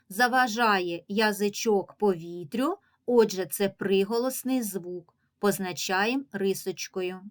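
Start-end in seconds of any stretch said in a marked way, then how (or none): none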